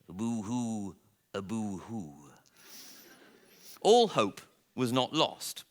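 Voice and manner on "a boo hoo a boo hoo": mock boo-hoo. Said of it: "off he went again".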